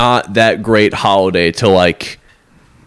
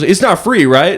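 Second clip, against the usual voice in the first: same bro tone; 7 semitones higher than usual. Retold or imitated. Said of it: "the exact same tone".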